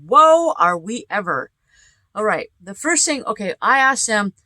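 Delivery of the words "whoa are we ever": excited whoa. Real, not acted. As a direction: exclaim in amazement. Excited exclamation.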